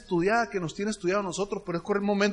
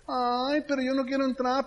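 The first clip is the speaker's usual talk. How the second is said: whiny complaint; 5 semitones higher than usual. Retold or imitated. Prospect balking at joining, whiny complaint. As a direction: imitate a reluctant prospect moaning. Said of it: "se conoce el lenguaje de la queja".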